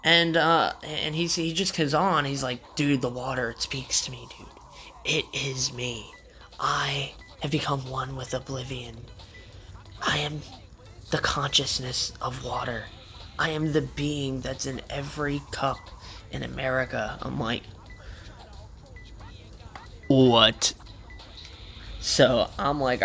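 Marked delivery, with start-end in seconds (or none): 2.8-17.2 mock mystical. Tripping friend declaring himself one with water, hushed and mystical. 20.1-20.7 loud, blunt disbelief; baffled. Retold or imitated. Storyteller reacting to his friend's water speech, loudly baffled.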